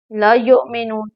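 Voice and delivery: ordinary talk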